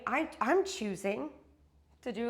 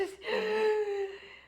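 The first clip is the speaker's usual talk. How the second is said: sobbing gasp; 11 semitones higher, 4 dB louder than usual. Real, not acted. Crying over goodbye.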